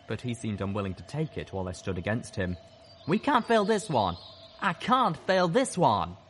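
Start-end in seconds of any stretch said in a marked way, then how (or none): 3.1-6.2 high, anguished outcry; desperate insistence. A narrator's reading. Gutshot unwilling to give up his mission, desperate high cry. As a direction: insist desperately, pitched well up.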